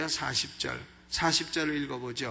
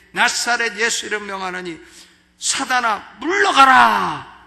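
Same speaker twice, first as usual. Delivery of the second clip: commanding rebuke; 5 semitones higher, 10 dB louder than usual. Acted. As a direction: cast out Satan with full force. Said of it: "우리는 명령을 해야 됩니다".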